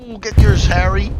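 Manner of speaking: loud, high whoop of delight; real shout of excitement for Harry; excited cheer. Real, not acted. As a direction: cheer him on as the kill lands. Cheering a player's kill.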